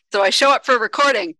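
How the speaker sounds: usual speaking voice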